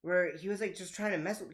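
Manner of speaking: normal talking voice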